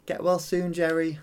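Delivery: put-on high, bright Liverpool Scouse accent. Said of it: "That was my Scouse accent".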